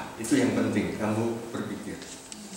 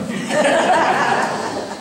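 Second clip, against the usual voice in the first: high laugh; 6 dB louder than usual. Real, not acted. Laughs at own remark.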